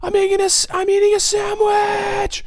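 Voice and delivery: very high, loud squeal of joy; mock squeal. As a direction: go wild over a sandwich.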